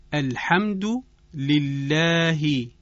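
slow, careful Arabic recitation accent; deliberate Arabic pronunciation with drawn-out vowels. Shown as a demonstration. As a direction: model it in a Qur'anic Arabic accent, stretched out. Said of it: "این صداها رو با لهجهٔ عربی".

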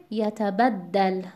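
یتبدل with "bad" stressed; yata-BAD-dal for یتبدل. Shown as a demonstration. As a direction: lean on the ب syllable of یتبدل.

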